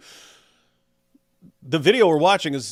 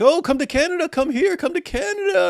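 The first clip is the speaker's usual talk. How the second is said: much higher, pleading falsetto; pleading officials; 5 dB louder than usual. Pretend. Canada's officials begging the famous streamer, squeaky pleading. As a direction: beg sweetly in a squeaky voice. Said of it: "and they're like".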